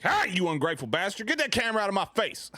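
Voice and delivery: high, loud hammed-up angry yell; mock angry outburst. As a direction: play it up as an outraged shout at a camera. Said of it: "Mike would be like".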